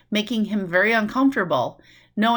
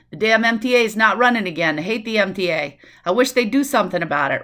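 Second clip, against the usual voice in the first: a hint of fast New York chatter; lightly voiced New Yorker griping; New York talk; 4 dB louder than usual. Shown as a demonstration. Teacher showing how New Yorkers chat, mild New York. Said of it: "It's very fast. That's how we talk".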